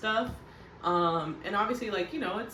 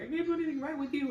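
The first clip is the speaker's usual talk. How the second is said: mock crying whine; 10 semitones higher, much faster than usual. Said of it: "now she's crying, and she's like".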